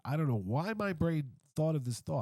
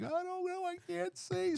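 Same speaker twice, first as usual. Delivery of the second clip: much higher, dazed and helpless; mock panic. Blinded by the flash, answering the angry woman in a high whine.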